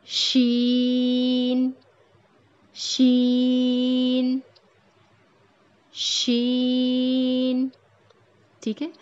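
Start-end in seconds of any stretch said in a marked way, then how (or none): 0.0-7.7 شین starting from a hushing sh, held very long; drawn-out sheen. Shown as a demonstration. Teacher models شین very slowly for students, hushing sh. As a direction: start like a shushing sh, then stretch it. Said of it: "extend کرنا ہے اور نون پینٹ کرنا ہے".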